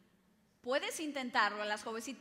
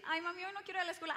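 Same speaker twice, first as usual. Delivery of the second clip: put-on fast, whiny little-girl plea; mock child begging fast; whiny child to mom; 6 semitones higher, much faster than usual. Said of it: "Yo podía decir".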